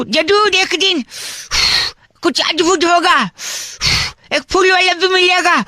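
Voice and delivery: high phrases, each followed by a puff; puffing between phrases. Pretend. Prank caller tells a stranger of his hopes, puffing between phrases. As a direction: blow out air after every hopeful phrase. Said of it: "इतना फूंका है मैंने".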